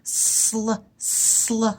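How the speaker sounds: "sl" isolated, soft and drawn out; sounding out blend. Shown as a demonstration. Teacher isolates the sl blend for young spellers. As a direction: sound just the "sl" blend softly. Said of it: "I hear that S L blend".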